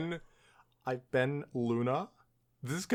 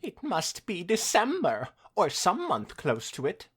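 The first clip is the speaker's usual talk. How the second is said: villain mare musing; 6 semitones higher, 4 dB louder than usual. Acted. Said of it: "Nightmare said aloud to no one but herself".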